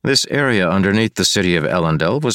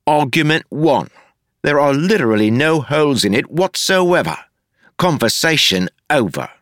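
mock-grand declaration; 9 semitones higher than usual. A narrator's reading. Wayne pompously ending the argument with Marcy. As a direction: announce victory like a judge.

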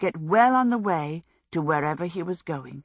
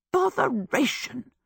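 slow, higher-pitched vexation; mild exasperation; 8 semitones higher than usual. A narrator's reading. Sophie left behind the slammed door, mildly exasperated. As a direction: let out a mild, drawn-out curse.

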